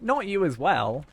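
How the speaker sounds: slow exasperated groan of words; drawn-out playful dismay; mock despair. Real, not acted.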